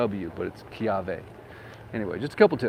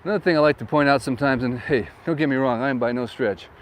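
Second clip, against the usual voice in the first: ordinary delivery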